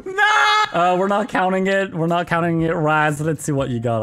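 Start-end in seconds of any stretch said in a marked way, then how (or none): 0.0-0.6 high loud no; anguished protest. Real, not acted. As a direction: cry out in defeat. Caught laughing, protests.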